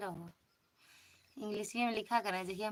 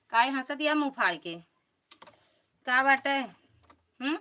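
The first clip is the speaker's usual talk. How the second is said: loud teasing; 5 semitones higher, 7 dB louder than usual. Real, not acted. Teasing a laughing viewer.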